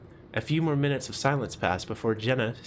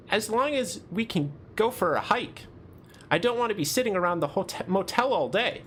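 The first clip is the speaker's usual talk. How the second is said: a higher, upbeat character voice; lightly voiced eager friend; 8 semitones higher than usual. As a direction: lift the pitch for a keen companion.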